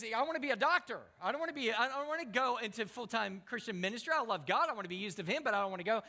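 imitated pleading, high and loud; distressed protest. Young man argues against full-time ministry, distressed protest.

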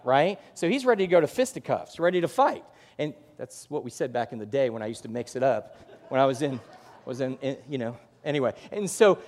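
ordinary talk, nothing marked

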